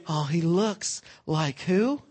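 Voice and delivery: ordinary talk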